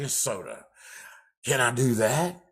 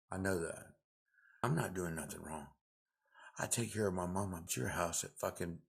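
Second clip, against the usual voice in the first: usual speaking voice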